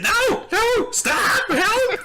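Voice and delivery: very high, loud panicked yelps; mock cries for help. Half-orc shoves him in the water, mock panicked cries. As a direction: yelp like a hammed-up victim being dunked. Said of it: "He's like".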